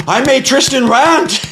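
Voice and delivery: very high, slow, gloating bird voice; gleeful rooster. Pretend. Rooster boasts to his wife, very high gleeful voice. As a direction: stretch it out, proud and squeaky. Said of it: "He's like".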